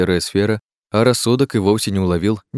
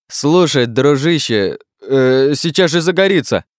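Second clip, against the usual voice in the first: raised, high-pitched nervous warning; alarmed worry; 6 semitones higher, 4 dB louder, slower than usual. A narrator's reading. Klazen sees light break through Lars's fingers, alarmed worry. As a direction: blurt it out anxiously, stumbling on a filler. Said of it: "забеспокоился Клазен".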